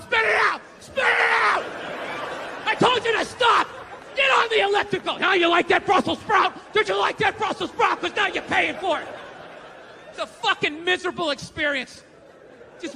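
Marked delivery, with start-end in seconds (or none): 0.0-9.1 much higher and louder barked commands; yelling chef. Pretend. Personal chef scolding the dieter, loud mock yelling. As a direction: bark orders like a drill sergeant.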